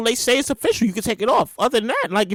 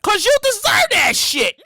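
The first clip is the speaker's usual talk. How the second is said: high, drawn-out mock scolding; 7 semitones higher, slower than usual. The public answering the reopened states, mock scolding. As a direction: throw it back as a comic told-you-so. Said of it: "we all gonna be like".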